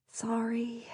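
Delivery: barely voiced sheepish sorry; a breathy sheepish whispered sorry. A narrator's reading. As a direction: mouth an apology sheepishly. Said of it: "mouthed sheepishly".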